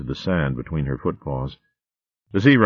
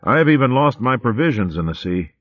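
ordinary delivery

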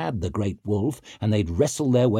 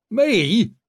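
surprised grunt; 12 semitones higher, 5 dB louder than usual. A narrator's reading. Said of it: "grunted Downside".

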